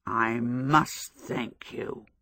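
low, slow, mushy voice of a stuffed scarecrow. A narrator's reading. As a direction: speak as if your mouth were full of damp straw. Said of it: "its mushy voice".

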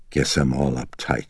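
ordinary delivery

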